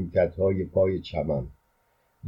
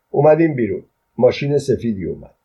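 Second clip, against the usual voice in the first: plain reading voice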